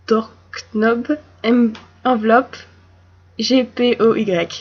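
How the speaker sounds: words and letters in a French accent; French accent, a little amused. Shown as a demonstration. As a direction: say them as a French reader would. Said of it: "And with the French accent".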